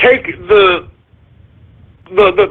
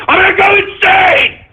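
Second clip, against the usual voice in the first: a high, raised wail of exasperation; frantic shout; 9 semitones higher than usual. Real, not acted. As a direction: burst out, at the end of your rope.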